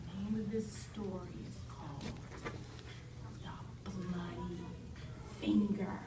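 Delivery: teacher announcing the spooky story title. Acted. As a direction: say the title like a secret.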